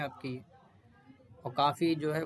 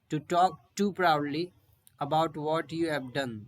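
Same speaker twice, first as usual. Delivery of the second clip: very slow, word by word; slow dictation; 4 dB louder, much slower than usual. Shown as a demonstration. Teacher reading the definition aloud, slow dictation. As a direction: read it out slowly for notes. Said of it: "reads the definition slowly".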